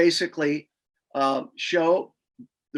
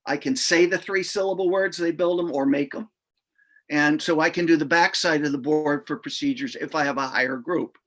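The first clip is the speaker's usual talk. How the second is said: normal talking voice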